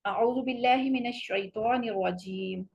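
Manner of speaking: chanted tajweed recitation; Quranic recitation. Shown as a demonstration. Teacher recites the taawwuz as the correct model. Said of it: "یہ صحیح تلفظ اس کا".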